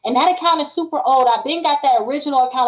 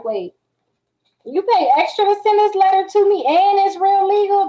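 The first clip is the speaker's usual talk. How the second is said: high, loud disbelief at the certified letter; a debt collector startled that the letter is certified, loud and high; shocked collector; 8 semitones higher, 3 dB louder than usual. Retold or imitated. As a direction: play the startled collector caught off guard.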